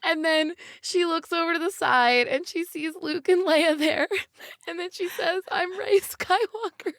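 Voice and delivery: high, tear-filled retelling; real tears while recounting the film's ending; crying while telling. Real, not acted. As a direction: push through the ending while welling up. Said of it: "You're crying too".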